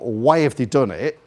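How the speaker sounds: normal talking voice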